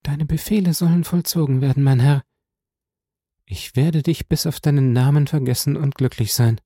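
dutiful but hurt, high voice; submissive slave girl. A narrator's reading.

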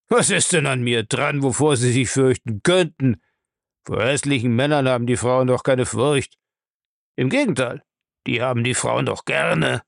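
a gruff old man, mocking and pleased; teasing old man. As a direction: tease her, enjoying himself. Bellamy teases.

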